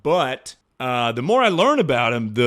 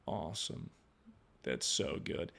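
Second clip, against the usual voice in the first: weak, very soft, low deathbed murmur; faint, low last words; dying man; 7 semitones lower, 17 dB quieter than usual. Retold or imitated. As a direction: play a feeble man on his deathbed. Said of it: "when he was on his deathbed".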